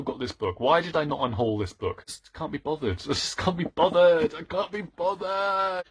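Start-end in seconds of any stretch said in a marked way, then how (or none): 2.0-3.0 soft, muttered complaint; understated exasperation. Acted. Vlogger questions keeping the book, softly fed up. First take of escalating complaint. 3.0-5.9 hammed-up exasperation. Vlogger gives up on the book, exaggerated whine. Repeated complaint turns into whine.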